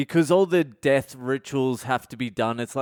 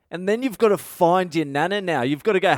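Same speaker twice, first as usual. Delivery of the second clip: normal talking voice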